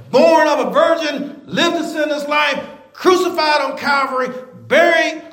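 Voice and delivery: emphatic preacher with drawn-out key words; preaching. Real, not acted. Preacher tells a church full of professed believers, emphatic preaching. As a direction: proclaim it to the church in punched phrases.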